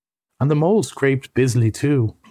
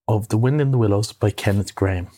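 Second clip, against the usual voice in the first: usual speaking voice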